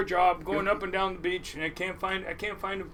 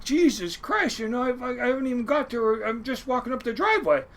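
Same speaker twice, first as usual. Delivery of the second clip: mildly alarmed, higher-pitched protest; raised pitch, acting out being taken aback; startled protest; 7 semitones higher than usual. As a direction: retell it as a bewildered, innocent objection. Startled reaction to being screamed at.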